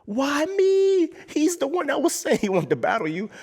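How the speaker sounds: very high, frightened squeal; scared. Retold or imitated. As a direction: plead like you're terrified. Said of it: "I'm scared".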